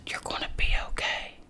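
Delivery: soft reassuring whisper. Retold or imitated. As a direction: breathe the comfort. Nurse whispering reassurance to patient.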